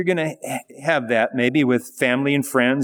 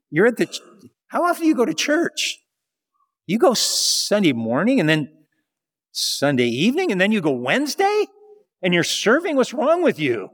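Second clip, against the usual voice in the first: high-pitched, incredulous questioning; mock ridicule; 5 semitones higher than usual. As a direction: imitate baffled critics, voice jumping up. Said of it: "they might even ridicule you".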